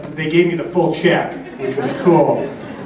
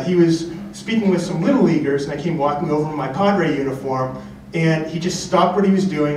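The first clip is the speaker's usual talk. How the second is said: ordinary talk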